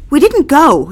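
sharp and loud; defiant outburst. Acted. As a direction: daughter snapping at mother. Daughter blurts the truth.

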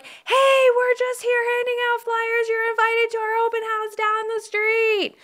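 high-pitched, hammed-up Puerto Rican voice. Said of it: "put my Puerto Rican voice on".

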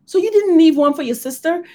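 high, loud accusing question; mother scolding son for eating it all; yelling mother. Retold or imitated. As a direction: scold him, pitch way up.

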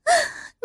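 a short high-pitched laugh, falling. A narrator's reading. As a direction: let out a quick high giggle. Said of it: "笑着说".

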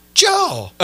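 a high, loud, startled call of the name; shocked cry of a name. Retold or imitated. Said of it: "All shocked".

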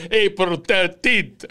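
slow teasing imitation of the Italian accent; host parroting the guest's Italian accent; mock Italian accent. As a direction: drawl it out like the guide. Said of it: "Is that the accent?".

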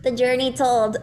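squeaky high cartoon voice; chipmunk-high helium pitch. Shown as a demonstration. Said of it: "Do it one more time".

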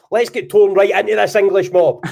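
high and loud battle call; rousing Scottish rallying cry. Shown as a demonstration. Scottish host rallying troops, loud rousing cry. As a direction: fire up the troops against the English. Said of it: "so, right, hold on".

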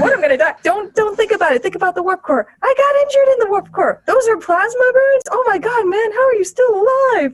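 high, panicked patient voice; hammed-up terrified patient. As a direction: squeak out mounting alarm. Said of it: "the guy's like".

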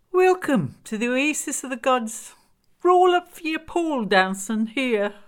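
high, loud mock-welcome; sardonic Glenda character. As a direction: announce it like a scornful fairground crier. Glenda's voice in narrated story.

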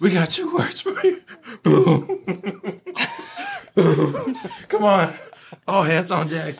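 high, laughing voice. Real, not acted. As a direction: laugh through the words.

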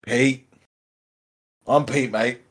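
easy Australian twang, casual and chatty; Australian accent. Acted. Pete the parakeet tells the panda about himself, in an Australian accent. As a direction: play the cheeky Aussie parakeet. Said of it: "From Australia".

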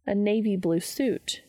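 plain reading voice

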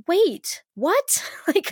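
high-pitched startled double take; acted disbelief. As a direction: play a sudden, baffled realisation. Voicing a woman's sudden realisation.

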